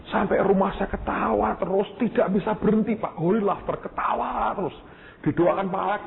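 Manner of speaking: bubbling over with laughter-filled delight; giddy wife. Retold or imitated. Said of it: "Saya bilang". (suara istri yang girang, lebih tinggi)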